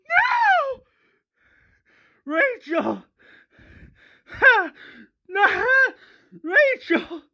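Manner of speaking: acted grief-stricken crying; broken, high-pitched sobbing cries; high anguished wailing. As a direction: wail for your dead daughter, very high. Acted father finding dead daughter.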